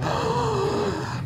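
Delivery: sudden high gasp of emotion; a caught, choked gasp; sharp gasp. Retold or imitated. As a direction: catch the breath as emotion rises. Emotion rising mid-swim.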